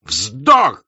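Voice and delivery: loud, sharp cry; shouting. Acted. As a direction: fling the word out loud. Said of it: "закричал он так".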